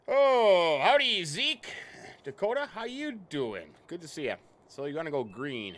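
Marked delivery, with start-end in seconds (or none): 0.1-4.3 high, loud, delighted hello to chat; light up as friends drop in; excited greeting. Real, not acted. Streamer greeting chat viewers, excited and high.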